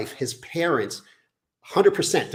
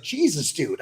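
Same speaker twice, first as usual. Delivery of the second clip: imitating appalled buddy; slower than usual. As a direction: retell a friend's startled reaction. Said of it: "He's like".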